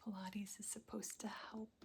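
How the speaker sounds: ordinary talk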